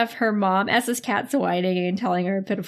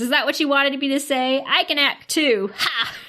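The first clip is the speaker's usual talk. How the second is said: mocking gotcha; 5 semitones higher than usual. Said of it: "she starts to laugh".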